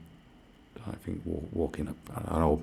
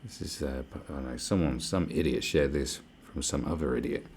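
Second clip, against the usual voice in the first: ordinary delivery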